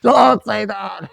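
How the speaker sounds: very high, loud protest, slowing at the end; imitating friend begging him to stop; mock panicked shout. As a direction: yelp to stop him saying it. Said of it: "he's like".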